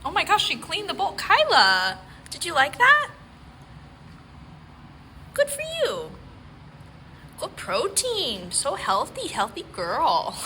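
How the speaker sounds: high, loud sing-song praise for the dog. Real, not acted.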